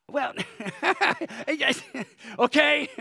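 high bursts of laughing around a quick "okay"; giggling break mid-sermon; real laughter. Real, not acted. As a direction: laugh it off and try to move on. Preacher laughs at her own story.

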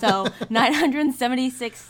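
giggling while restating the figure; laughing. Real, not acted. Co-host laughing as she repeats the stateroom count.

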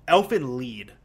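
"Elfen Lied" with Lied said like "leed"; "Elfen Lied", long ee in Lied; pronounced as in show. Shown as a demonstration. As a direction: say the Lied in "Elfen Lied" to rhyme with need. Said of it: "it's pronounced".

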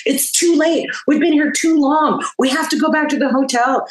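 high-pitched mock pleading; retold begging. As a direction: act out begging your bandmates to leave. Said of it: "I remember begging our band".

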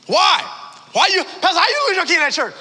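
high, loud disbelief; can't believe the pastor lost a kid; incredulous. Retold or imitated. Friend asks pastor in disbelief, high and loud.

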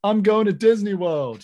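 hammed-up victory catchphrase; triumphant athlete. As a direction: shout it like you just won the Super Bowl. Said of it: "And the player always responds".

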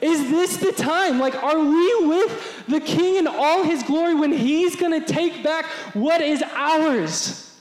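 high, slow, thrilled crowd voice; excited hope. Retold or imitated. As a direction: ask it breathless with hope. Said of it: "they're saying".

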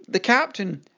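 ordinary delivery, nothing marked

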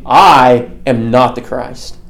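slow line with a long, swooping "I"; stress on I. Retold or imitated. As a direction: draw out "I", then say "am not the Christ" deliberately. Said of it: "this puts the emphasis on I".

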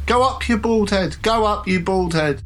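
put-on children's taunting cry. A narrator's reading. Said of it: "the same sort of cry of the children saying".

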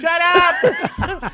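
loud, high shut up; annoyed snap. Pretend. Yelling at panicking passengers to shut up. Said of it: "telling the criers to stop".

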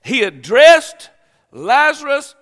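preacher lifting to a shout; emphatic preaching. Real, not acted. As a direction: hammer the words home.

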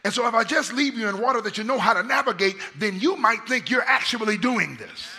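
high, loud pulpit delivery; full-force preaching. Real, not acted. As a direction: drive it home at the top of your preaching voice.

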